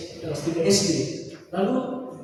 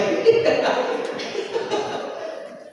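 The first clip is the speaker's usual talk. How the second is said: a long breathy laugh at his own joke; amused chuckle at himself; airy laugh. Real, not acted. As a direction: let the laugh roll out without voice.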